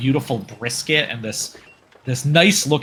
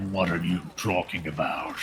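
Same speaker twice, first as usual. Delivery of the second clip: low, quiet orc growl; low gruff orc; 8 semitones lower, 9 dB quieter than usual. Acted. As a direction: answer like a surly orc.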